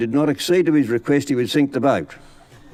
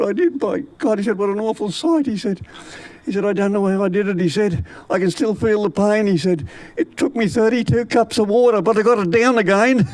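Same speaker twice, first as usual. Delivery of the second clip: put-on sick old man; 9 semitones higher than usual. Sick Charlie's complaints.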